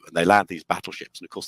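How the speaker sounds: ordinary delivery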